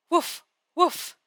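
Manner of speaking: high, lightly voiced dog bark. A narrator's reading. Narrator voicing a dog at a duck, high playful barking. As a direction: read out high storybook woofs. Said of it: "The dog was barking at the duck".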